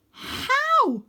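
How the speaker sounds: put-on boy alarmed at climbing a dragon; panicked question. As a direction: squeak it out in alarm. Scared boy asks.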